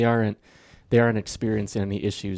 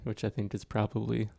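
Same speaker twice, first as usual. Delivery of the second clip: ordinary delivery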